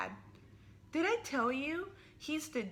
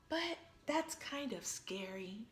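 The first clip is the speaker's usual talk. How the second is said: usual speaking voice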